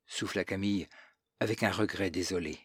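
plain reading voice

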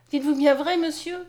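high, eager exclaimed question; crying out. A narrator's reading. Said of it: "s'écria Mariette". (s'écrier avec espoir, voix plus aiguë)

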